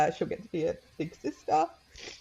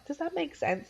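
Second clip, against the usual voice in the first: put-on gentle question to child; 11 semitones higher than usual. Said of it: "I just remember going to her".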